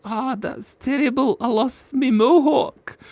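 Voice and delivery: high, slow hammed-up lament; mock dismay. Streamer imitates Derek mourning his lost mohawk, mock sorrow. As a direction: whine it like a silly loss. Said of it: "you're like".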